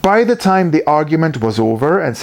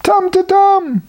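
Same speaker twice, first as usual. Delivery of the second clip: high faint wail; trapped Tumtutum crying out faintly, high. A narrator's reading. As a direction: call weakly from beneath the tree. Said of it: "ever more faintly came".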